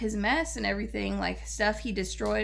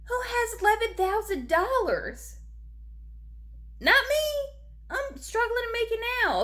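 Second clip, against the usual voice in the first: squeaky, much higher exasperated voice; high-pitched disbelief; 13 semitones higher than usual. Real, not acted. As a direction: push the voice way up in disbelief. Outraged at service dog price.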